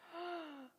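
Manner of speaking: short shocked intake of breath; acted gasp. Teacher telling children of snake bites, mock shocked gasp. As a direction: gasp as if just bitten.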